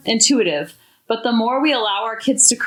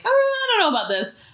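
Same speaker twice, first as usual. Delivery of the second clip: much higher and quick, skeptical wince; doubtful; 11 semitones higher, faster than usual. Retold or imitated. Reacting to a comment she finds too much, high skeptical doubt. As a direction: pull back in mock doubt. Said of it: "there's a few that I'm like".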